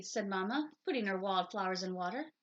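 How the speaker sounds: ordinary delivery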